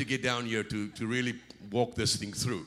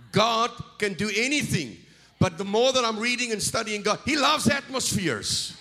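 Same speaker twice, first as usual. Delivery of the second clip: higher, louder and building to the end; full-force preaching; 10 semitones higher, 6 dB louder than usual. Real, not acted. Preacher rallies the congregation about atmosphere in full-force preaching. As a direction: preach it up to a roar.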